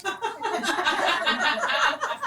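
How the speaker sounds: high, breathy burst of laughter; soft giggling laugh. Real, not acted. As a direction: laugh before the reveal. Laughing at the punchline setup.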